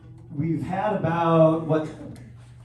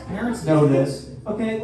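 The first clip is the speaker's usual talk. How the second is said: ordinary talk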